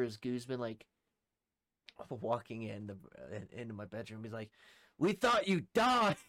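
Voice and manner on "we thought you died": high, loud, drawn-out exclamation; imitated astonishment. Roommate as he walks into the bedroom, imitated loud astonishment. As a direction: mimic a stunned friend's holler.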